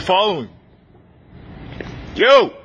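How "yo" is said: calling out. Real, not acted. Calling out across the store.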